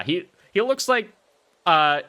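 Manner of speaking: usual speaking voice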